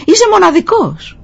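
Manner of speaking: high-pitched retold father's call; encouraging shout. Father calls praise for his son to echo, high shout. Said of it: "Φώναξε πίσω ο μπαμπάς".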